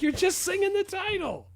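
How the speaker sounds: very high-pitched mock exasperation; exasperated protest. Telling mom she only sang the title, high mock exasperation. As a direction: protest in a squeal of disbelief.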